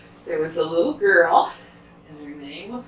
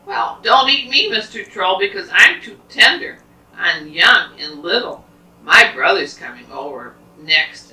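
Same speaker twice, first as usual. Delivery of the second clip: lightly voiced goat, louder plea; pleading little goat; 2 semitones higher, 9 dB louder than usual. Little goat talks troll out of eating him, lightly pleading. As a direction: plead with a bit of urgency.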